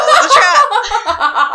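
high, loud, drawn-out through laughter; laughing outcry. Real, not acted. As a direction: cry it out slowly while cracking up. Laughing outcry ending her story.

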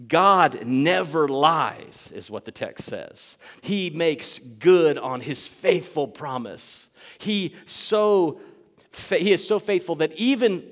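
slow, loud, pounding pulpit delivery; emphatic, drawn-out proclamation; forceful preaching. Real, not acted. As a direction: hammer each phrase home to the congregation.

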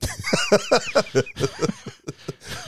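high-pitched burst of laughter. Real, not acted. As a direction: laugh at your own quack. Laugh after quacking.

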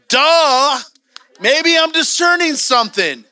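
loud, high-pitched dawning realization; mock your own slowness; exaggerated, drawn-out duh; self-mocking duh. Mocks himself for realizing late.

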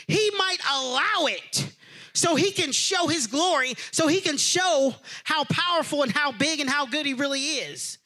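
high, loud pulpit preaching; preaching at full force. Real, not acted. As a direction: drive the message home to the congregation. Preaching the lepers story.

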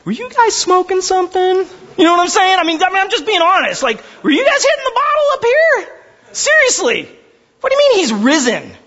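high mocking "were you guys smoking something"; incredulous disbelief. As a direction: play the baffled skeptic, pitch way up. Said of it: "they would have said, like".

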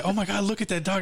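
high, hammed-up mimicry of her; mocking imitation. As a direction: imitate her gushing over a dog. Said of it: "whenever I imitated".

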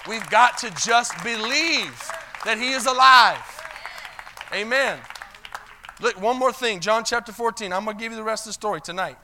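loud revival preaching; preaching at full force. Acted. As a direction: shout the faith out to the congregation.

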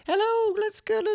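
tiny, very high squeak; wrong voice for Hagrid. Shown as a demonstration. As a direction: squeak it out, comically miscast as Hagrid. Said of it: "then I would do wrong ones".

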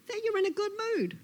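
very high, quick lizard voice; knowing Lizard. Acted. Lizard tells Snake what is pretended, knowing. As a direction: answer quickly, pitched right up.

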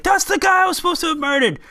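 high, strained mock yell; movie-scene yell, imitated at high pitch. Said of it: "he's yelling".

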